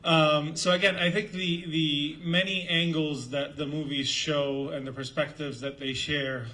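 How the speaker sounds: loud, projecting classroom voice; teacher voice. Real, not acted. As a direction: project to the back of the room. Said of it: "I'll use my teacher voice".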